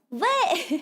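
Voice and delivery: high-pitched "what"; playful incredulous yelp; mock disbelief. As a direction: react with comic disbelief. Mock surprise at a chat comment.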